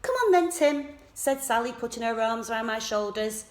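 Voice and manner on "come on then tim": put-on bright, high, loud call to the children; rally the kids cheerfully; lively call. A narrator's reading. Sally urging children along.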